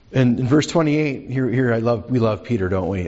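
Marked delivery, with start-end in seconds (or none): none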